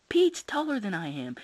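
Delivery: put-on high, loud protesting kid; crying out. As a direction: wail it like a little boy. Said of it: "Toby cried".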